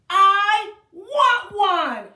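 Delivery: slow, loud, high wail; child yelling. Acted. Child yelling for a cookie, slow high wail. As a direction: drag it into a howl. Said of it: "she yelled".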